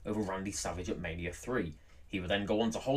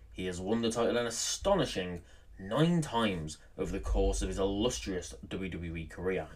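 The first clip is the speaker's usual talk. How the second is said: ordinary talk, nothing marked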